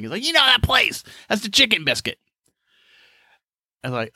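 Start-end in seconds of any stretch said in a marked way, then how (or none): 0.2-2.2 put-on high, insistent old-man voice. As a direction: grandpa sure you know the place. Grandpa voiced in story.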